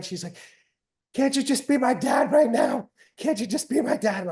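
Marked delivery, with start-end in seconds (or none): 1.1-4.2 higher, louder mock tearful plea; imitating crying daughter. Daughter in the hallway after a loss, pleading in mock tears. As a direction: play the upset kid begging dad to stop coaching. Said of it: "She's, like, crying".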